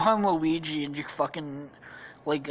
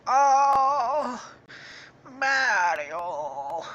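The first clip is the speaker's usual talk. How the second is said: Luigi, dying; 8 semitones higher, 3 dB louder, much slower than usual. Acted. Brother dying with spears in his head, fading groan. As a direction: moan it out as a hammed-up dying breath. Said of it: "laying down there, he's like".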